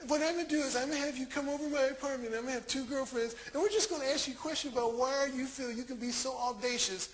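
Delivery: imitated woman. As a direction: rattle off her plan in a put-on woman's pitch.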